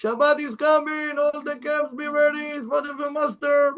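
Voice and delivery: high, sustained chanting call; proclaimed announcement. Acted. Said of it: "there is announcement".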